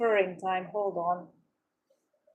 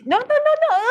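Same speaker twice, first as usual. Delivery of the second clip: a sudden, much higher, louder "no, no" squeal; 13 dB louder, faster than usual. Real, not acted. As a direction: squeal the no's at the cat, way up high. Owner squeals at her cat.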